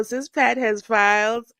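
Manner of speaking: ordinary talk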